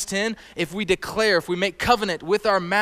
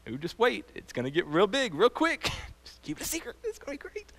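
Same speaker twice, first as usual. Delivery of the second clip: playful God voice; 5 semitones higher, 7 dB quieter than usual. Retold or imitated. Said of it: "It's like".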